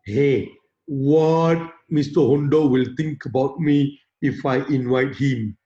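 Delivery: low, slow worried inner voice; fearful self-talk. Acted. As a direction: mimic someone dreading what others think. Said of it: "They always have the fear".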